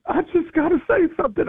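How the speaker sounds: host voicing the eager lady who has to speak; high eager lady voice. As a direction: rush it like the eager church lady. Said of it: "she said".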